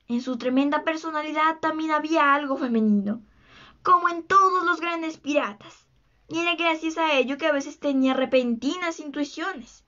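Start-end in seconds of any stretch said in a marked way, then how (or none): none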